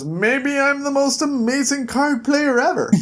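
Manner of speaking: a hint of a smug old stranger bragging; cocky old man. Old man boasting to Jack about his cards.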